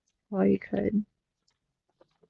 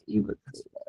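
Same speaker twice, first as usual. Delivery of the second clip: breathy whispered mutter to self; whispered aside; 12 semitones lower, 13 dB quieter, much faster than usual. Real, not acted. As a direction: drop it to a whisper. Repeats the remark aside.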